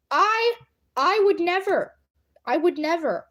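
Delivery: high, put-on innocence; mock-offended denial. Feigned innocence about giving accents.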